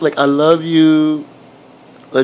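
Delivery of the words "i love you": put-on slow, lengthened model phrase for a baby; drawn-out coaxing. Shown as a demonstration. Adult coaxing baby to say something nice, drawn out. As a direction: stretch the words out slowly.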